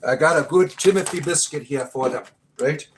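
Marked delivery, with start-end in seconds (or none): none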